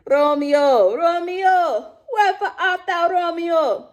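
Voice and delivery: high, sing-out Caribbean accent; exaggerated, badly faked Jamaican lilt, pitched well up. Shown as a demonstration. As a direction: declaim Shakespeare in a hammed-up Jamaican accent. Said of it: "Romeo and Juliet are Jamaican or Caribbean".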